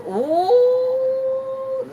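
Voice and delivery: high, loud drawn-out ooh; a squealed ooh of surprise; delighted ooh. Real, not acted. Hearing a viewer is Italian too, high ooh.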